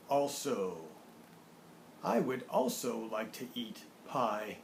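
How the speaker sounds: put-on slow, deliberate model reading for young learners; measured teaching read-aloud with drawn-out key words; word-card reading. Shown as a demonstration. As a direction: announce each word and sentence distinctly, unhurried. Reads word cards with example sentences.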